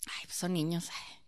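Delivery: whispered careless excuse; hushed mock of lazy teachers. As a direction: breathe out a dismissive shrug.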